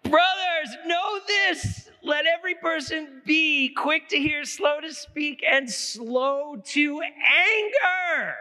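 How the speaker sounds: high, booming scripture proclamation; thundering preacher. Acted. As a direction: call it out, stretching the last words very slowly. Mock-thunders the verse after the joke.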